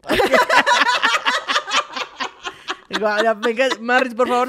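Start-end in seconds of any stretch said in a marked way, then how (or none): 0.2-3.0 long shrieking laugh; real cackle of laughter; high burst of laughter. Real, not acted. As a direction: let a big high laugh out. Big laugh.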